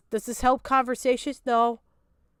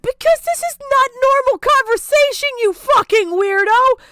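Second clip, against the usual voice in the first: high, loud, furious rant; exasperated yelling; 10 semitones higher, 8 dB louder than usual. Real, not acted. Yelling at the creator about a weird question, furious. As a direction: blow up at how absurd this is.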